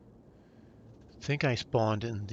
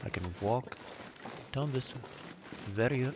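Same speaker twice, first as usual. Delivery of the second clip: a dry, mock-formal British accent; dry British accent, lightly put on; 3 dB quieter than usual. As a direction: play the stiff British gentleman, deadpan. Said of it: "my very dry British accent".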